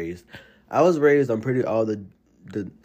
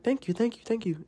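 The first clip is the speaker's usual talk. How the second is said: high grateful thanks; 12 semitones higher, 4 dB quieter than usual. Retold or imitated. Grateful family voiced high.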